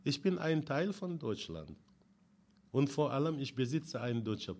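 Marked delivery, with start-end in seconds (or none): none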